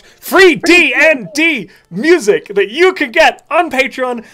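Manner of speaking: slow, high and loud, losing track; drawn out as the words run away; flustered slowdown. Real, not acted. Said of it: "I'm spiraling".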